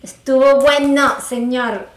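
loud, slow, firm reenacted telling-off; putting him in his place. Woman reenacts stopping her partner over chores, firm telling-off. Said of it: "Yo le pongo el pare al toque".

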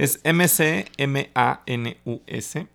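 slow, letter by letter spelling. Shown as a demonstration. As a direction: spell out each letter slowly. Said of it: "el apellido también hay que deletrearlo".

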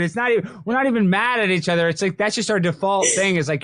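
usual speaking voice

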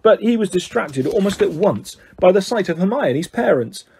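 usual speaking voice

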